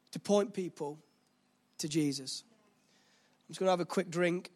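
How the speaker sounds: ordinary talk, nothing marked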